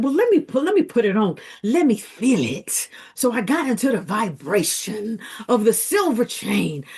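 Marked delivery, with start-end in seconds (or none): 0.0-2.9 eager to try it on. Retold or imitated. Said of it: "I said".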